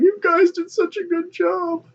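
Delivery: high, slow, tearful eagle voice; Sam Eagle choked up. Retold or imitated. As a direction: voice Sam Eagle near tears. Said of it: "Sam Eagle just, like, is breaking down".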